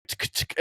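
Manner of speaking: mouth-made bolt action clicks; imitated gun clicks, unvoiced; fake rifle-loading sound. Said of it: "fake sounded like I was loading it".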